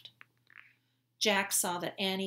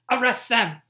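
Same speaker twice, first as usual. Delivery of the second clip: loud order; 5 semitones higher, 14 dB louder than usual. Acted. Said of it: "said Redface".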